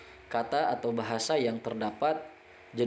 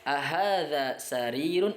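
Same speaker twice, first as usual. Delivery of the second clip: Ahadza baitun drawn out slowly as a question; teacher modelling Arabic yes-no question; slow model question; slower than usual. Shown as a demonstration. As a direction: read the question slowly for students. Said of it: "apakah ini ranjang?".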